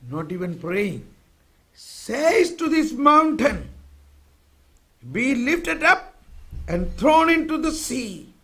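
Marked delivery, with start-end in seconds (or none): 1.8-8.3 loud, high, slow proclamation; forceful scripture declaiming. Real, not acted. Preacher proclaims the mountain verse to his listeners, loud and forceful. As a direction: proclaim it slowly, driving every word high.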